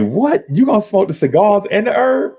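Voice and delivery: high, surprised questioning; can't believe it, pitch up; shocked. Retold or imitated. Shocked at cigars and herb.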